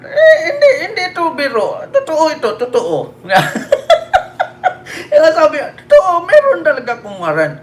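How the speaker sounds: high, laughing almost to tears; real laughter, close to crying. Real, not acted. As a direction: cannot hold the laughter back. Host cracks up retelling.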